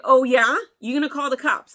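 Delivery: loud, mocking comeback; loud challenge. Daring teens who threatened cops, loud mocking. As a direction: throw their threat back at them.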